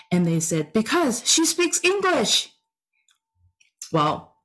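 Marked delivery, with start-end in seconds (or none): 0.7-2.5 put-on high-pitched child's matter-of-fact answer; retold kid's reply, much higher; imitating a child. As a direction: answer like a kid stating the obvious, pitched up. Retold kids' blunt answer.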